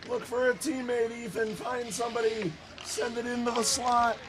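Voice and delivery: high-pitched coaxing call; urging on. Real, not acted. Announcer urging Ethan to find a teammate, high urging. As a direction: urge the player on in a high voice.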